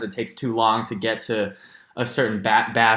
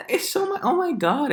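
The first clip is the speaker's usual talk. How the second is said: gushing. Real, not acted. Gushing over pangolin.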